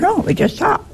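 high, pressing No; insistent. Retold or imitated. Speaker insists to the sisters, high and emphatic. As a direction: insist that they simply talk. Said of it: "I said".

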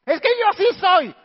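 defensive excuse. Acted.

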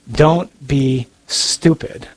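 DON'T BE STUPID, drawn out word by word; slow, deliberate repeat for emphasis; slow emphatic warning. Shown as a demonstration. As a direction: say "Don't be stupid" very slowly, each word separated.

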